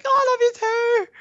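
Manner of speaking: very high, loud cooing falsetto; mock sweet reply. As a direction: coo back sweetly in a squeaky voice. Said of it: "I was like".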